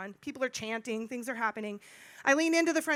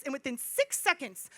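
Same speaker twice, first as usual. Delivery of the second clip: ordinary talk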